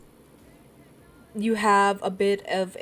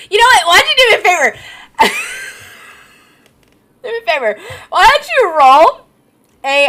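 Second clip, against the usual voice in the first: loud laughing outburst; 12 semitones higher, 12 dB louder, much faster than usual. Real, not acted. GM breaks off in laughter.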